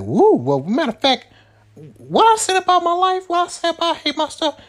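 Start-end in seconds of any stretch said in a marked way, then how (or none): 0.0-0.3 cheer. Retold or imitated. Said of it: "you like". 2.0-4.6 mocking himself. Mocks his own earlier complaints.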